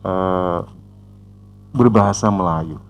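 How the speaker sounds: ordinary delivery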